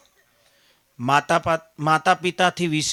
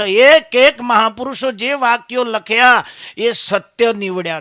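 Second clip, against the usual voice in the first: loud, raised preaching voice; preaching at full force; 4 semitones higher, 9 dB louder than usual. Acted. Preacher telling the gathering the saints' words came true, loud proclaiming.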